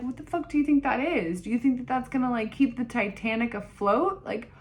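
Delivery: high, hammed-up incredulous rant; exasperated disbelief. Patient challenging doctor over the tampon advice, exasperated disbelief. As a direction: retell it as an outraged challenge.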